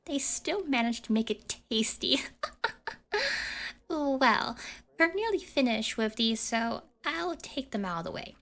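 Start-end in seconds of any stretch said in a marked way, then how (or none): 2.2-3.8 high breathy giggle. Acted. Accomplice amused at the prank, airy giggle. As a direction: giggle lightly to yourself.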